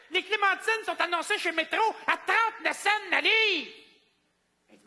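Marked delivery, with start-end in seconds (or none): 0.1-3.7 high indignant lady. Retold or imitated. Customer complaining.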